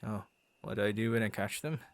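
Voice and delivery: usual speaking voice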